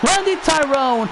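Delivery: excited play-by-play call; wrestling commentator. Acted. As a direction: build up the big finish. Play-by-play climax.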